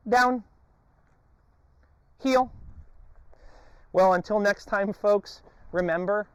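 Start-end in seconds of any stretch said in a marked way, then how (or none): 0.1-2.5 high, loud call to the dog; sharp command. Real, not acted. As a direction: snap the command out.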